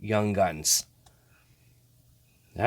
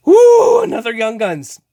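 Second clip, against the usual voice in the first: normal talking voice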